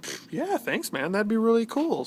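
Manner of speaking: teasing high-voiced impression; mock pipe maker. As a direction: lightly ham up his thanks. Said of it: "And he's like".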